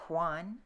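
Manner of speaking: "Juan" as hwahn, for when; breathy unvoiced WH. Shown as a demonstration. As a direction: blow air through the WH of "Juan". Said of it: "You'll be breathy".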